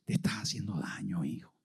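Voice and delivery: hushed, low, gentle rebuke; tender and soft. Acted. God to lost Saulo, hushed and tender. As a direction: soften it like a loving father.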